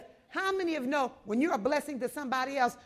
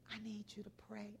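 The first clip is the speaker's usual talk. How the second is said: fast, softer whispered begging; whispered plea; 20 dB quieter, faster than usual. Retold or imitated. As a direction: breathe the plea urgently. Old lady pleads for prayer.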